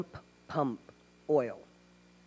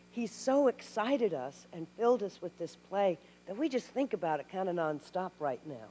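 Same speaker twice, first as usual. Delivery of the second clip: normal talking voice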